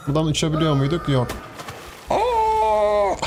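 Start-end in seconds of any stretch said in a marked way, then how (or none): 2.1-3.1 high falling yelp of fright; sudden high startled cry. Real, not acted. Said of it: "çocuk gibi bağırıyor".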